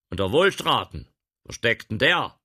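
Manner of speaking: loud gentleman's question. Acted. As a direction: demand it. Gentleman asks.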